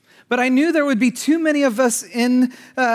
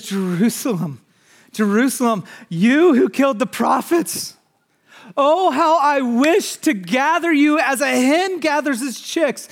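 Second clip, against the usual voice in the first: impassioned cry, building higher and louder; weeping lament; 5 semitones higher, 4 dB louder than usual. Acted. Preacher voicing Jesus grieving over Jerusalem, impassioned lament. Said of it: "He actually weeps over the city".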